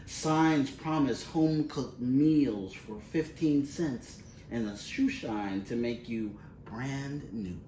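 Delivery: plain reading voice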